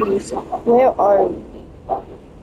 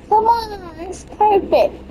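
pleading; 11 semitones higher, slower than usual. Real, not acted. Pleading with the game.

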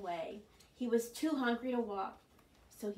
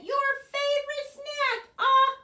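excited reveal; 13 dB louder than usual. Acted. Fox excitedly points out worm.

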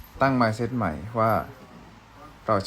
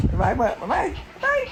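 cute squeaky voice. Pretend. Cute goodbye voice.

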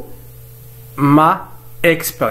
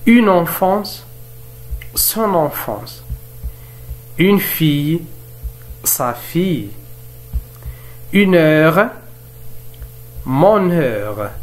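very slow, every syllable laid out; slow model reading; 3 semitones higher, much slower than usual. Shown as a demonstration. Teacher reading a model phrase to students, very slow. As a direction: read it slowly for the class to repeat. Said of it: "teacher models the pair".

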